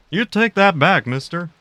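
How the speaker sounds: high, indignant cartoon dad, starting loud; mock-angry drawling threat; Hank Hill impression. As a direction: threaten like an outraged suburban father. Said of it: "thank you for the Hank Hill impression".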